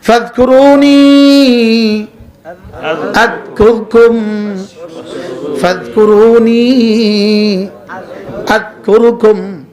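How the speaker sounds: slow, long-held final syllables; Quranic recitation. Real, not acted. Preacher reciting the verse to his congregation, slow drawn-out recitation. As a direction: recite the verse slowly, stretching each vowel.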